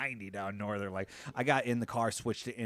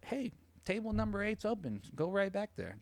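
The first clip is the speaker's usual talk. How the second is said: high sweet clerk; 9 semitones higher, 3 dB quieter than usual. Retold or imitated. Clerk voiced in story.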